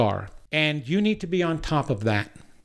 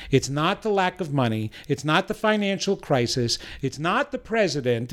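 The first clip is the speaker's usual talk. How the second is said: ordinary talk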